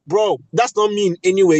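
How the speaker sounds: ordinary delivery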